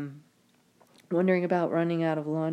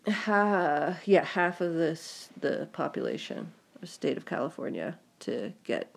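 ordinary talk

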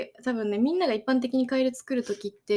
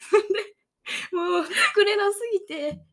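after laughing hard; 6 semitones higher, 4 dB louder than usual. Real, not acted. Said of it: "息できないぐらい笑いながら".

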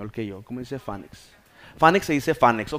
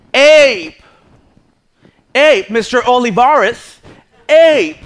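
very loud, high, drawn-out English correction; exasperated English teacher; 10 semitones higher, 17 dB louder, much slower than usual. Retold or imitated. Teacher correcting her struggling pupil, loud and insistent in English. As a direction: imitate a teacher hammering the right English word. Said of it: "la maestra".

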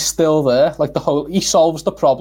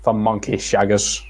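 lower, slow Tom Baker line; lightly voiced Tom Baker, deeper and slower; Tom Baker impression; 6 semitones lower, slower than usual. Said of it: "got another Tom Baker line here".

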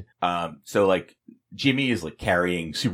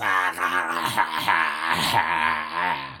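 hammy evil villain cackle; mustache twirling villain laugh; 4 semitones lower than usual. Acted. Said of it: "That's my villain laugh".

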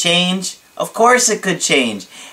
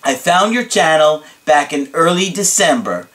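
normal talking voice

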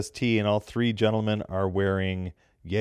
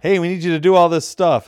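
loud and high, mimicking a pushy booker; mock booker demand; 9 semitones higher, 9 dB louder than usual. Booker at the building barking last-minute demands, loud and high. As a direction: bark it out, much higher and louder, like a booker making demands.

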